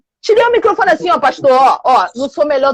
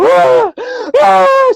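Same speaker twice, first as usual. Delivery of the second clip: high, louder crying wails; imitated crying wail; 12 semitones higher, 4 dB louder than usual. Pastor imitating the woman crying at the altar, high wails. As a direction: wail "ah, ah" like the woman weeping on the floor.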